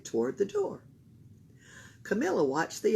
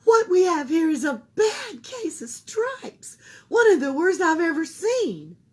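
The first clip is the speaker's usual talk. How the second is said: put-on old woman voice; 11 semitones higher, 7 dB louder than usual. A narrator's reading.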